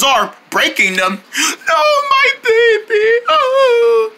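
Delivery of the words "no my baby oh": slow, high drawn-out wail; pretend grief. Owner mourning a broken guitar, high slow wail. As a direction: mourn it in a long cartoon howl.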